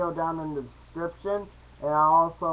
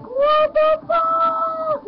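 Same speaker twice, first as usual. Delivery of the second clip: very high, drawn-out mock scream; imitated freak-out; 8 dB louder, much slower than usual. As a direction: shriek it slowly in cartoon disbelief. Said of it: "one that's like".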